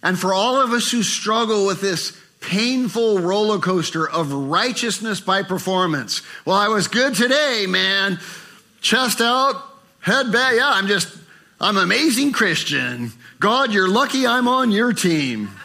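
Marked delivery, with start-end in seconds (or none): 6.4-15.5 proud boaster. Retold or imitated. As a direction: puff up like a proud believer.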